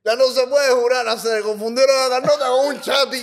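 loud mock outburst from the dad; dad's joking shout. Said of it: "con el puro y dice".

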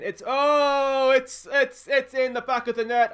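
can't get the words out for joy; goal celebration. Real, not acted. Manager watching a late goal: excited yell.

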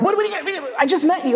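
high, bewildered squeak of disbelief; the date caught off guard, voice jumping up; startled date. Retold or imitated. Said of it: "They were like".

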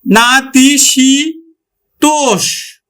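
না, তি, সি, তোষ as separate drawn-out syllables; teacher's syllabified না, তি, সি, তোষ; phonetic sounding-out. Shown as a demonstration. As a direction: sound out না-তি-সি-তোষ, ending on a long sh. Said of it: "দীর্ঘ ইকার উচ্চারণ করি না".

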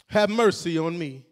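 ordinary talk, nothing marked

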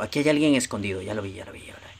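quick, low murmur fading out; voz baja y sigilosa. Pretend. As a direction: hush it as if the enemy could hear. Spots hidden enemy, drops voice. (bajando la voz con sigilo)

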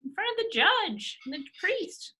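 high, taunting jab at the husband; waving the certificate, high and taunting; mock gloating. Grandma taunting her straying husband, high and taunting. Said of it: "would you just wave it in his face".

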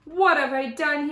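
lightly acted alarm of a giraffe; mild dismayed cry. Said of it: "he cried".

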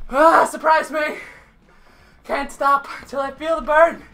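usual speaking voice

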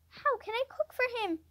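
high, mildly alarmed question; frightened cry. A narrator's reading. Mary learning she must cook for him, frightened cry. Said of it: "she cried".

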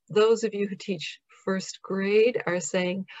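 usual speaking voice

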